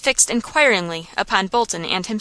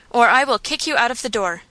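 plain reading voice

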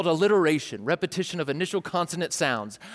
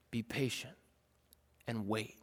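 much softer, calm reply; God answering his people, soft and calm; 8 semitones lower, 14 dB quieter than usual. Pretend. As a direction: say it soft and still. God's reply voiced.

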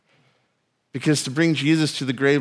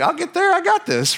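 much higher, breezy and unbothered; carefree Jesus; 14 semitones higher than usual. Retold or imitated. Jesus telling disciples not to worry, airy high voice. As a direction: toss it off lightly, a confident high shrug. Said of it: "He's like".

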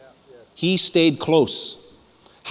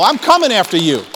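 mildly raised, forceful preacher's threat; defiant challenge; 7 semitones higher, 4 dB louder than usual. Acted. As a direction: stand up to the devil. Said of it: "I tell the devil".

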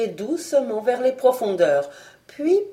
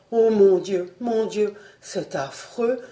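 doleful murmur. Acted. Fred on his knees moans in despair, a doleful murmur. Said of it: "murmurait-il d'une voix dolente".

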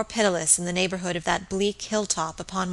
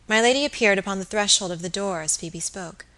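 ordinary delivery

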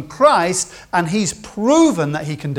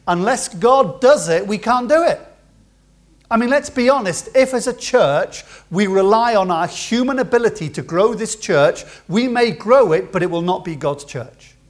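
louder, higher preaching push; full-force preaching; 3 semitones higher, 5 dB louder than usual. Acted. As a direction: drive the point home to the church.

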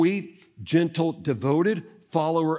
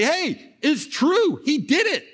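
high, breathless joyful news; women running to tell everyone, excited; 11 semitones higher, slower than usual. Acted. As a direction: burst out with the good news.

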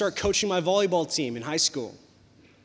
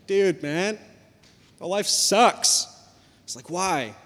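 put-on whiny teen; 8 dB louder, slower than usual. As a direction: wail the last words like a heartbroken kid. Teen whining to mentor.

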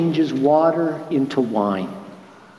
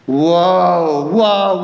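stunned whoa; 4 dB louder than usual. Retold or imitated. Nathanael stunned by realization.

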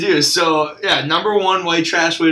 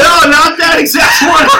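loud, high, laughing protest; laughing shout; 13 semitones higher, 9 dB louder than usual. Real, not acted.

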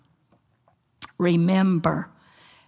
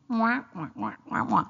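donkey bray; 5 dB quieter than usual. Retold or imitated. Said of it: "I was like the donkey".

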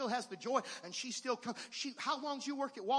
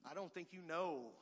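voicing Job; 6 semitones lower, 6 dB louder than usual. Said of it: "Job said".

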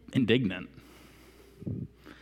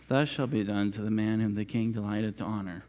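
lower, softer, grudging delivery; reluctant mutter; 11 semitones lower, 5 dB quieter than usual. Retold or imitated. Haman leading Mordecai, grudging and reluctant. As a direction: force the words out unwillingly. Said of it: "With Mordecai".

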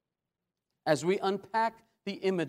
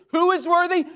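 loud, high ringing challenge; calling out; 9 semitones higher, 8 dB louder than usual. Acted. Preacher repeating the angel's question to all creation, loud high cry.

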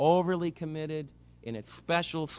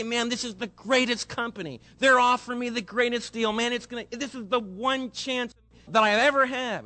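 eager husband pitch; 6 semitones higher than usual. Retold or imitated. Husband pitching the big deal to the preacher, eager and high. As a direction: sell it like the deal of a lifetime. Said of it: "I'll say".

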